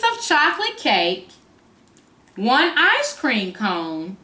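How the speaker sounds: slow, expressive storybook reading; reading to a toddler. Acted. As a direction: read it like a picture book for little ones. Reads the picture book aloud.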